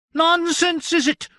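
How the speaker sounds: very high, loud indignant servant; wronged attendant crying out; outraged cry. Acted. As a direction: shriek the grievance. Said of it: "cried the man".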